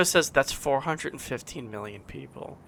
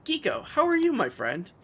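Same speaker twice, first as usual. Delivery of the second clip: cheery chat greeting; 8 semitones higher than usual. Retold or imitated. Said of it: "Geeko says".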